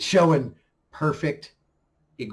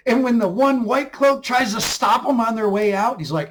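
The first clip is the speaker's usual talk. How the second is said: usual speaking voice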